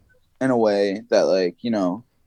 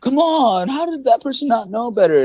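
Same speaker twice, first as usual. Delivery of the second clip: mock indignant scolding; 13 semitones higher than usual. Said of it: "people are just like".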